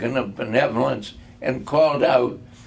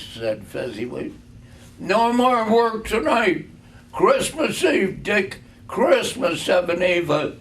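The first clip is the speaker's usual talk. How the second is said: jovial Fezziwig; 6 semitones higher, 3 dB louder than usual. A narrator's reading. Fezziwig jovially calls out again.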